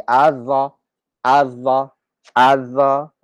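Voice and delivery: az-za with a doubled z, repeated; az-za, no l heard; assimilated az-za. Shown as a demonstration. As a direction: drill az-za, lam merged. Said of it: "We don't say al-za".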